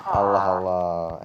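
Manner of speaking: long drawn-out Allah Allah, slow and weary; "Allah Allah" stretched slowly, weary and fed up; exasperated sigh. Acted. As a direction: drag out "Allah Allah" in tired disbelief.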